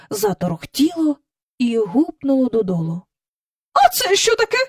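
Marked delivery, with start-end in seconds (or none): 3.7-4.6 startled. A narrator's reading. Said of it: "підхопилася місіс Бенкс".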